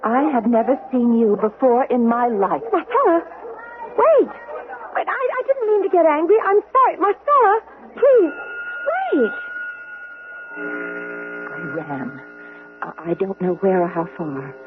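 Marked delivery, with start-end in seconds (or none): 2.7-9.4 pleading call. Acted. Sister calling after Marcella desperately. 10.6-14.5 story narration. A narrator's reading. First-person narration over music.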